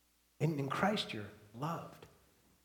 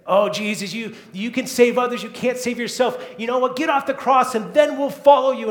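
jeering, raised and louder; mocking taunt; 6 semitones higher, 7 dB louder than usual. Crowd at the cross taunting Jesus, a loud jeer. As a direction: taunt him like a scornful crowd.

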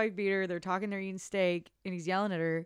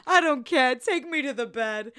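swooning surrender; 4 semitones higher, 8 dB louder than usual. Retold or imitated. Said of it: "She goes".

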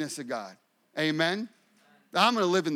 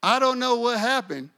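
higher, louder imitated Southern accent; little Southern voice; 7 semitones higher, 8 dB louder than usual. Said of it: "in her little southern voice".

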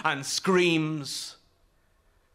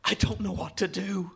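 higher, more pressing urgent plea; anxious, pleading urgency; urgent rasp; 5 semitones higher than usual. A narrator's reading. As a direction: raise the pitch with urgency, as a boy at a loss. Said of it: "his voice a rasp of urgency".

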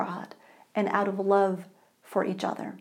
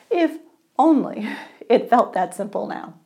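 wry laugh; 4 dB louder than usual. Real, not acted. Preacher's wry aside.

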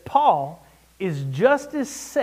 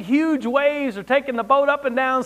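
normal talking voice

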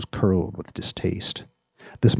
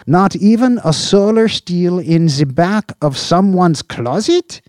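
high, loud, pushy boss voice; a boss grilling his subordinate; Slope, demanding boss; 12 semitones higher, 12 dB louder than usual. Acted. Police chief grilling an officer over missing agents, demanding.

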